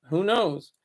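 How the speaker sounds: usual speaking voice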